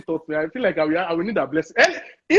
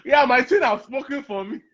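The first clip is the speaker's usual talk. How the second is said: laughing; 6 semitones higher than usual. Real, not acted.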